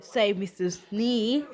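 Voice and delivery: plain reading voice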